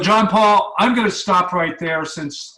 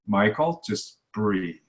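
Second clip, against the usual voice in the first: low, soft soothing mother; calm a worked-up kid gently; a mother's hushed reassurance to her son; mom soothing; 6 semitones lower, 5 dB quieter, slower than usual. Retold or imitated. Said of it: "And she would say".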